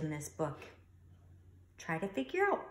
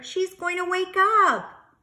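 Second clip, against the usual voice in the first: much higher, slow, louder worried warning; 12 semitones higher, 13 dB louder than usual. Acted. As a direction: panic that the baby will wake. Mobile plays, baby might wake.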